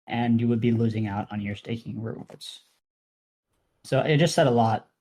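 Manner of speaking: ordinary talk, nothing marked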